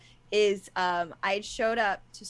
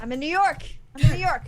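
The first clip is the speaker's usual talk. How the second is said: louder and higher, calling over a dropping line; 7 semitones higher, 9 dB louder, faster than usual. Real, not acted. Telling the host where she lives over a bad line, calling out. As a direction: raise the voice so the host can hear.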